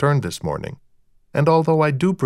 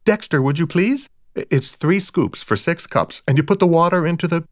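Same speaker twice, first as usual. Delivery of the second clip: higher woman's voice, careful then hurried; fussing wife; 6 semitones higher than usual. A narrator's reading. Rita instructs Dexter on making coffee, fussing. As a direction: fuss through instructions, speeding up.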